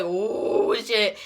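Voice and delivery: long drawn-out crowd "ooh"; onlookers' reaction. Retold or imitated. Imitating lunch tables watching a fight, scandalized reaction.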